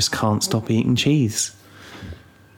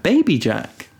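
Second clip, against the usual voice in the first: put-on higher, cutesy toddler voice; imitating toddler; 6 semitones higher, slower than usual. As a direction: say it like a proud little kid. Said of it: "She's like".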